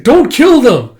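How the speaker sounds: very high, loud plea; acted frantic yell; desperate shout. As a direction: cry out to stop an attack. Shouting to halt the killing.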